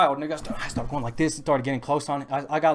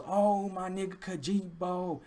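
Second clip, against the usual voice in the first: high, drawn-out holler without real volume; imitated yell; 5 semitones higher, 5 dB quieter, slower than usual. Passenger yelling to his friend from the car, mock holler. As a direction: stretch it out like a mock call. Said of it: "he, he yells".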